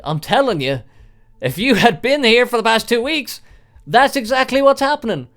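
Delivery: exasperated insistence. Retold or imitated. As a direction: make the doctor believe you. Insisting at the doctor.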